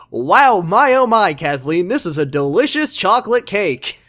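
high, loud mock amazement; hammed-up delight. Host imitates a reverend praising his hostess's cake, hammed-up delight. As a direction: gush over it like bad stage acting.

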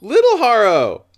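high loud shriek; delighted shriek. Real, not acted.

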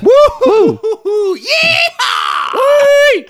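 high excited woo-hoo; cheering whoop. Real, not acted. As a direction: whoop with joy. GM cheering survival.

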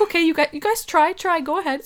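high, indulgent mom voice; amused mom. Retold or imitated. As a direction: humor the kids, pitched high. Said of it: "she's like".

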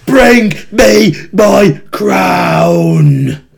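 slow, loud, high Geordie proclamation; Geordie brogue. Acted. Podcaster imitating wrestler Neville demanding a crown, Geordie brogue. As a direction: proclaim it in a drawn-out Geordie accent. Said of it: "he says in his Geordie brogue".

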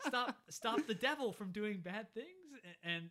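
high, slow, shouted mock prayer; struggling to keep it together. Boy disguising shouts at the hand as grace, frantic. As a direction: blurt out orders disguised as grace, on the edge of laughing. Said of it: "he's trying to keep it together".

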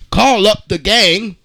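normal talking voice

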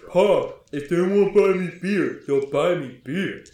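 loud oafish holler to his pa; bumbling yell. Acted. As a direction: yell it like a big lummox pleading. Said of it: "And so he yells and he says".

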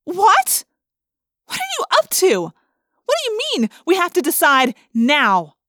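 high, loud, incredulous outburst; yelling. A narrator's reading. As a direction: yell it in shocked disbelief. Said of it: "I yelled".

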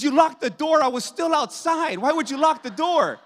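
high-pitched, comic outraged complaint; indignant protest. Retold or imitated. Husband locked outside with a bear protests to wife, high indignant retelling. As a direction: protest it high, playing up the disbelief.

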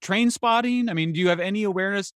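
exasperated, pitch shooting up high and quick; are you kidding me, pitched high; incredulous. Real, not acted. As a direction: disbelief bursting out at the absurdity. Incredulous rant on drug policy.

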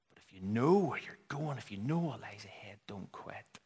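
whisper, fading almost to nothing; urgent whisper. Real, not acted. Preacher urging the listeners not to quit, whispering. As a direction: plead softly, barely audible.

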